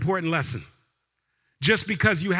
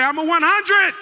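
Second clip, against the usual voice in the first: loud, high, rising shout; rallying cry; 9 semitones higher, 5 dB louder than usual. Real, not acted. Speaker leads the room in declaring themselves, rousing shout. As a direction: shout it so the whole crowd joins in.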